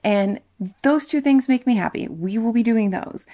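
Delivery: ordinary talk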